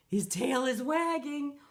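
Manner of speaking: usual speaking voice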